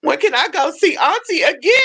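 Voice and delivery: put-on high pleading child voice; whiny kid voice. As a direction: beg like an excited little kid. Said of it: "he'd be like".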